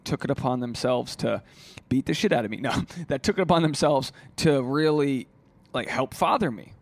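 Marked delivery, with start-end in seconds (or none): none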